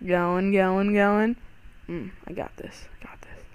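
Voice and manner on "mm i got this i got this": whispered to himself in focus; hushed, concentrating self-talk. Real, not acted. Focused on a hard jump.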